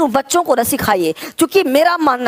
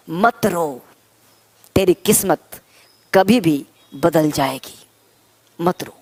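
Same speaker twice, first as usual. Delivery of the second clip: consoling; 7 semitones lower than usual. Pretend. Teacher telling students not to cry, low soothing recitation. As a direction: console gently, pitched well below normal talk.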